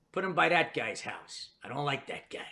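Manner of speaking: low-volume conspiratorial instruction; imitated mother plotting quietly; mother's sly aside. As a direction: drop it softly, as a confidential order. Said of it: "my mother goes like this".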